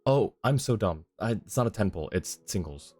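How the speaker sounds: usual speaking voice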